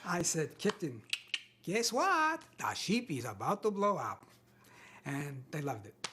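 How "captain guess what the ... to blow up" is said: put-on comic Russian accent, leaping high on "guess what"; funny Russian-accented reading. Actor redoing the audition line to be funny, comic Russian accent. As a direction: play the disaster for laughs as a chirpy Russian. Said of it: "could you make it funny?".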